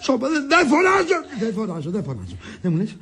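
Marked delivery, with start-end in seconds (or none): 0.1-1.2 high, agitated protest; shouted denial. Acted. Husband told not to shout at ailing wife, shouting. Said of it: "Μη φωνάζεις όλον". 1.4-2.4 low, soft placating voice; hushed backing down. Acted. Husband placating his ailing wife, suddenly quiet.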